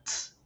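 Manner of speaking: a lone "ts", a sharp puff of T; unvoiced T sound. Shown as a demonstration. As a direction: demonstrate "ts" as a bare T, no voice. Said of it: "como la T de tetero".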